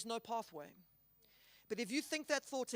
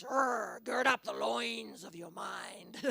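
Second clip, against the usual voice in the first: Scottish accent; 5 dB louder, slower than usual. Shown as a demonstration. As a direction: growl it out like a Scottish warrior in a Scottish accent. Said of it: "You kind of wanna say that in a Scottish accent".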